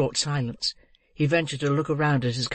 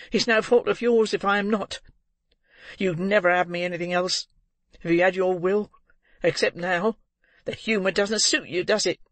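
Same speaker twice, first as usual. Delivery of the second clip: put-on high-pitched taunting young woman; bitter; 5 semitones higher than usual. A narrator's reading. Girl blaming the old man for her state, bitter. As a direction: needle him in a high, hard voice. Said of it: "cried the girl bitterly".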